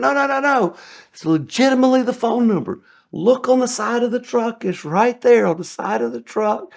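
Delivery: high, pleading protest; frantic insistence. Retold or imitated. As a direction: convince him fast before he blows. Pleading with furious customer.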